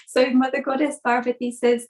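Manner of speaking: plain reading voice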